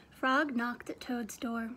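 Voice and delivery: big, loud storybook reading with acted voices; lively read-aloud. A narrator's reading. Teacher reading Frog and Toad aloud to her class, animated. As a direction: read it like a show for the kids.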